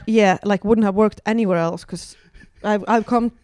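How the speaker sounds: normal talking voice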